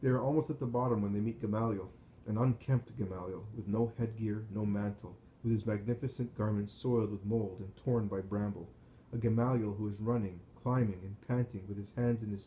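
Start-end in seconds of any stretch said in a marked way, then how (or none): none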